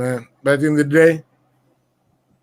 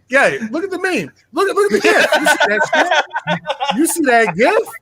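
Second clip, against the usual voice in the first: high, excited pointing at the meme; laughing, voice jumps way up; 10 semitones higher than usual. Real, not acted. Hyping up friends over a funny gif, high and excited.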